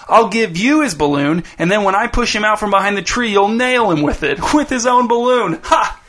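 put-on high gleeful kid's voice breaking into a laugh; gleeful scheming. A narrator's reading. Dez tells Jace his cruel plan, gleeful laugh. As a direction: relish the nasty plan and laugh.

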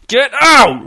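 high, loud, explosive order; screamed. A narrator's reading. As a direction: scream it with a drawn-out OUT. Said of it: "Reg screamed".

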